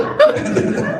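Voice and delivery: high loud laugh; burst of laughter. Real, not acted. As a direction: break out laughing. Laughing at mic mix-up.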